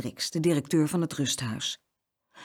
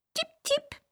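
very high, tiny bird peeps; tiny birds peeping from the cupboard; parakeet chirp; 4 dB quieter than usual. A narrator's reading. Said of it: "piepten de parkietjes".

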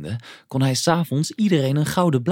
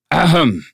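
high, loud attention-getting cough; loud throat-clearing; 5 semitones higher, 7 dB louder than usual. A narrator's reading. Moomin papa interrupts a daydreamer, loud throat-clearing. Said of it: "kuchte Moomin papa hard".

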